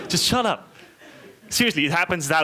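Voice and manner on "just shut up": high, exasperated retort; mock exasperation. Fed-up Austrian silencing kangaroo jokers, mock exasperation. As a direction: cut them off, mock-fed-up. Acted retort to those strangers.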